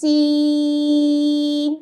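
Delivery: سین long with a whistling s; drawn-out seen. Shown as a demonstration. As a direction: stretch سین with a firm hiss. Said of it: "تو ہم پھر سے پڑھتے ہیں".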